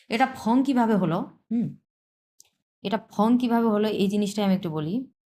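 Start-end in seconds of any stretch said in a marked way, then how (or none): none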